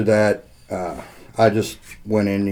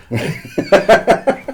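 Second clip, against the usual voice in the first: high, bright burst of laughter; real laugh breaking out; 12 semitones higher, 4 dB louder than usual. Real, not acted. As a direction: laugh out at the pay joke. Laughs at pay joke.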